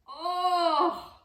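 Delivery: loud high yelp; cry out. A narrator's reading. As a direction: cry out as you fall. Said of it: "cried old Tom".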